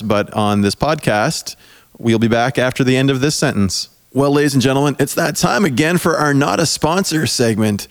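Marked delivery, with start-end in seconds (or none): 4.1-7.9 upbeat fast announcer pitch; ad read. Acted. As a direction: sell it like a radio host. Hosts Not a Sponsor segment.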